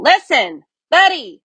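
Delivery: high, loud parental yell. Acted. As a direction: shout his name to get his attention. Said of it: "His parents tried yelling".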